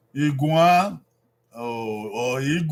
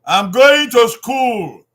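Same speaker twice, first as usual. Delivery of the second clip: slow, loud teacher model of I'm going to school; slow clear model sentence; 8 semitones higher, 11 dB louder, slower than usual. Shown as a demonstration. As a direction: say "I'm going to school" slowly and loudly, stressing "going".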